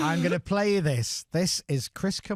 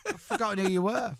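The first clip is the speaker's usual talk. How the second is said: much higher mock wife voice, bewildered; imitating the wife; 10 semitones higher than usual. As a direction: play the wife who no longer recognises her husband. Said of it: "Your lass must be like that".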